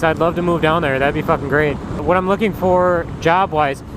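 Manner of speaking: ordinary talk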